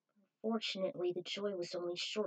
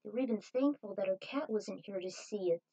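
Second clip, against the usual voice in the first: usual speaking voice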